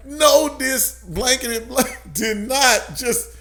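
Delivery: high, emphatic outrage, laughter slipping in; slow it down in amused disbelief and anger; comic retold indignation, word by word; retold fury. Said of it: "I'm furious".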